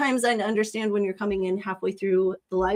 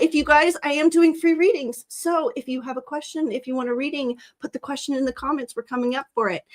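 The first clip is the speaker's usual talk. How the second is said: ordinary talk, nothing marked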